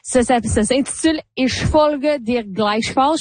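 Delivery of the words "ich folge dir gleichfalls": careful, slow attempt at German; self-mocked German accent. Real, not acted. Host apologizing for her German as she reads a title, German accent. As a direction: say the title slowly in tentative German. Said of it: "Et excusez mon allemand".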